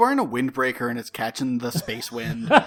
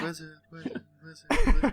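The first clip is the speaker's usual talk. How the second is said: mock echo; 3 dB quieter, faster than usual. Co-host echoes the title.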